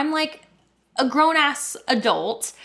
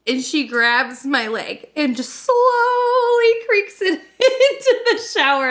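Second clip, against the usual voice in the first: louder, higher, laughing through it; cracking up retelling the moment; laughter bursts through the words; 10 semitones higher, 5 dB louder, slower than usual. Real, not acted. Laughing while telling the leg lift.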